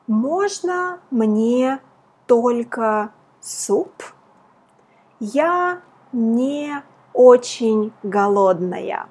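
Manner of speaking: slow, deliberate dialogue read for learners. Shown as a demonstration. Teacher reading the dialogue very slowly to students. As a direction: read each word slowly so students follow. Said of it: "I'm going to read it to you very slow".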